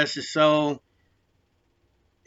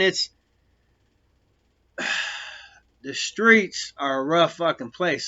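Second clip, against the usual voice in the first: ordinary delivery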